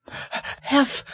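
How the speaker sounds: high, panting gasp; breathless little squeak; Piglet out of breath. A narrator's reading. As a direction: gasp the word between hard breaths. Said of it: "breathing so hard that he could hardly speak".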